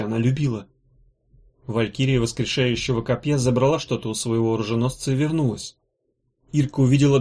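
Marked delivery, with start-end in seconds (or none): none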